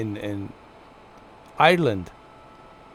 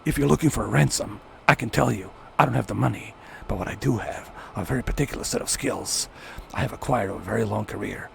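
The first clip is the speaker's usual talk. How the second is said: gentle badass movie threat; measured menace, sinking low and quiet; Liam Neeson; 3 semitones lower, 5 dB quieter, faster than usual. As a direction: lightly imitate an action hero, getting lower and softer. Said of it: "Liam Neeson has that powerful powerhouse of the voice".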